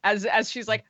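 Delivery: ordinary talk, nothing marked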